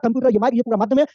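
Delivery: usual speaking voice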